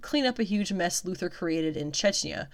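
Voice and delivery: ordinary talk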